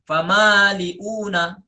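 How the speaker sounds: famaliuna drawn out slowly; slow recitation. Shown as a demonstration. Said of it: "kalau Imam Hamzah bacanya". (famaliuna dibaca panjang)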